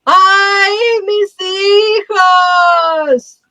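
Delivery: high wailing cry of grief; weeping ghost woman. Acted. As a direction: wail like a mourning ghost mother.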